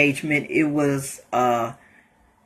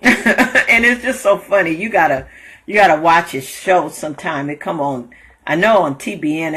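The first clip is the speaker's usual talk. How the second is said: ordinary talk, nothing marked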